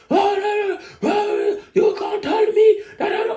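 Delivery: very high-pitched imitation of a man ranting; mock outburst, slow and squeaky high; hammed-up high-pitched protest; going off. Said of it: "He just goes off".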